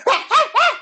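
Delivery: high, loud mock barking; a burst of yapping dog barks; imitating dog barks. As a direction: bark it out like the insistent dog. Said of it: "And then he went".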